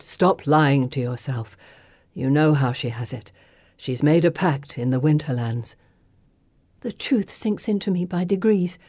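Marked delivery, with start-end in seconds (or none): 0.0-5.8 low, measured ancient spirit; cool, knowing sorceress; Circe's voice. Acted. Circe speaking.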